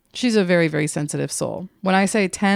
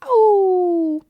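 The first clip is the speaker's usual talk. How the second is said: mock yelp; 13 semitones higher, 6 dB louder than usual. Mock pain yelp echoing friend.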